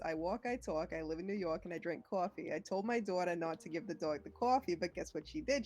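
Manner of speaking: a touch of New York, "dog" and "coffee" rounded; New York accent. Shown as a demonstration. Friend performs the whole New York accent rhyme. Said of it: "The full scope of the rhyme is".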